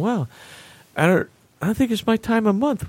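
dopey, high-pitched wonder; a hint of a spaced-out patient; stoned musing. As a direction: drift through it like you're heavily drugged. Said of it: "I'm so drugged up".

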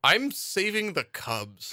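high, slow hammed-up line; bad acting. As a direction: say it slowly like a stiff actor. Acting out hero's reply.

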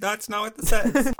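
ordinary talk, nothing marked

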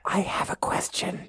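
unvoiced whisper, fast. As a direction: whisper a question in a library. Hushed library meeting role-play.

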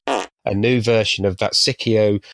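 usual speaking voice

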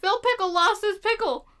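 high, dramatic cartoon-announcer call; Rugrats-style dramatic call; mock cartoon announcer. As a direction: announce it like a kids' show alarm. Repeats cartoon alarm line.

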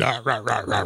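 gruff mock yapping in place of words; yelling like barking. Chewy mocks a politician's angry talk, barking nonsense. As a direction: imitate an angry man yelling like a dog. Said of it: "it sounds like he's yelling".

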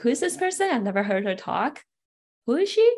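high, loud startled schoolgirl asking; surprised girl. Retold or imitated. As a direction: mimic a classmate caught off guard, voice shooting up. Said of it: "almost like with a surprised look".

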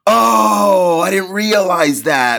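big, high, drawn-out mock amazement; imitated realization. Imitating Rosie told about a callback, loud high realization.

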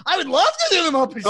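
high, quick, loud burst of enthusiasm; eager yes. Acted. Actor eagerly accepting a Muppet Show gig, gushing. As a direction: jump at the offer, bright-eyed and fast.

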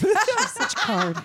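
high, slow, laughing prim lady voice; laughing mock prim. Teacher to friends, joking laughing prim substitute for a swear. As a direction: put on a laughing prissy voice.

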